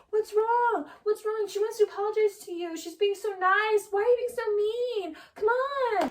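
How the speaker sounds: high-pitched mock-concerned coaxing, passive-aggressive; Tom Schwartz, condescending. Schwartz scolding Katie on Raquel's behalf, condescending and passive-aggressive. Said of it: "condescending little thing he does".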